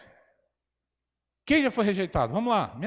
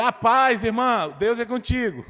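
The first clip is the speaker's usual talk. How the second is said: overly cheerful blessing; 6 semitones higher, 7 dB louder, slower than usual. Retold or imitated. Pastor greets accuser cheerfully.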